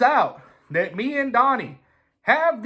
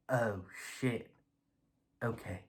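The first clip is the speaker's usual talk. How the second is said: much softer and lower, muttered oh shit; hushed dread; 10 semitones lower, 16 dB quieter than usual. Retold or imitated. Caught out by his old rival, a hushed dread. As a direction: say it under your breath, knowing you're caught.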